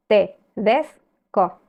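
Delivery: tedesco stretched very slowly, weight on DES; stressed syllable shown. Shown as a demonstration. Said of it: "Tiene el acento en des".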